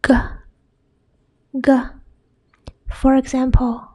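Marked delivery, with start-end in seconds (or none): 0.0-1.7 "g" as a short, hard guh; hard G sound. Shown as a demonstration. Teacher showing G before a, o, u, hard guh. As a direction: pop out "g" as an isolated guh. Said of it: "it's pronounced as".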